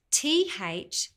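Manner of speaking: normal talking voice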